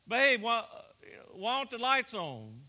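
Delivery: mild retold holler from the bathroom. Husband calls to his wife about the lights, hollering. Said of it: "He hollers back".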